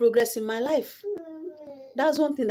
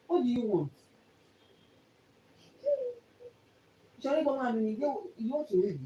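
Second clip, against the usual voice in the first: quiet coaxing; 5 semitones higher, 6 dB quieter than usual. Real, not acted. Asking crying child what's wrong.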